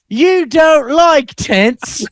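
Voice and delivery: put-on slow, high, loud British girl accent; British child accent. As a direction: drawl it like a little posh English girl. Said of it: "she's got this accent".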